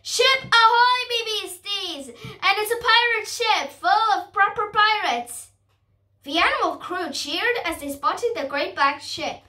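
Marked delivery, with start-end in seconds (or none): 0.0-5.5 high, loud lookout's cry; calling out. A narrator's reading. Crow lookout hailing the crew from above, calling out. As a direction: sing out the sighting, pitched well up. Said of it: "Bogey called out".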